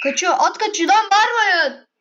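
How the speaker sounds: high, loud yell of alarm; real panicked shout at people yelling outside. Real, not acted. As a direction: shout it as the horse bolts. Horse runs, yelling at family.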